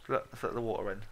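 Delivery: usual speaking voice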